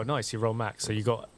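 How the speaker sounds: usual speaking voice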